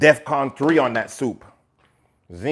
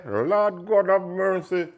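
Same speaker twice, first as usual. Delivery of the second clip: high, very slow lament; mock lament; 6 semitones higher, much slower than usual. Real, not acted. Groaning about the dentist appointment, slow and high. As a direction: drag it out like a plea to heaven.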